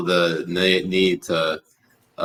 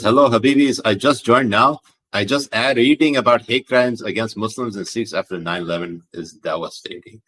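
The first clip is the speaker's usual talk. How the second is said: put-on lively reading in a Pakistani accent; 2 semitones higher than usual. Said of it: "read this in a fucking Pakistani accent".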